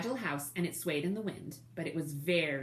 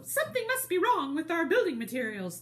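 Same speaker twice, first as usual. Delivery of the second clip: much higher, louder squeaky wolves; little wolf voice; 10 semitones higher, 4 dB louder than usual. Shown as a demonstration. Reader correcting herself to voice the little wolves, high and squeaky. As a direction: redo it as the worried little wolves. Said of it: "it's the wolves talking".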